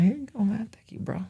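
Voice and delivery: breathy whispered hand-off of cash. Retold or imitated. Whispering the thanks while passing him the money. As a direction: hush it like a secret deal. Handing over cash in story.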